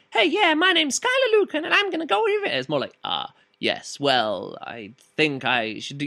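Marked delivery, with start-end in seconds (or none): 0.1-2.6 high-pitched, over-eager hero introduction; mock a gung-ho young action hero, pitched way up; exaggerated high, boyish bravado; brash young hero. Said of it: "guy isn't just a, like". 3.0-6.1 low, measured, hesitant mature man; slow, weighty gravitas. Retold or imitated. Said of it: "just taking it slower, taking it down a notch".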